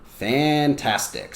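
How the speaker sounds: "Fantastic" slowly, in three separate beats; drawn-out syllables. Shown as a demonstration. Teacher models "Fantastic" for the class, syllable by syllable. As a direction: stretch each syllable of "Fantastic". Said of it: "Because it has three syllables".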